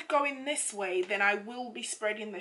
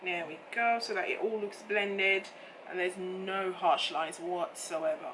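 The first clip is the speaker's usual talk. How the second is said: ordinary talk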